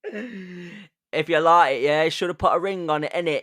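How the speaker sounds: mock British pop-star impression. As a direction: ham up the British slang. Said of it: "with a British accent".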